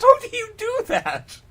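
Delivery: squealing with laughter, high; laughing. Real, not acted. Co-reader cracking up at the line, high laughing squeal. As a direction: laugh it out in disbelief.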